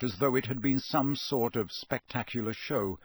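plain reading voice

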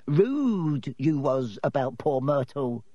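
slow, teasing imp voice; mischievous poltergeist. A narrator's reading. Poltergeist accuses the children of rudeness, tauntingly. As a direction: draw out the accusation with relish.

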